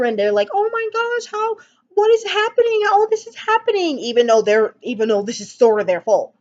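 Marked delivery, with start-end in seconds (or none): 0.5-4.0 mock surprise. Mocking women caught flour fighting.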